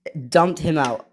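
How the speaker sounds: ordinary delivery, nothing marked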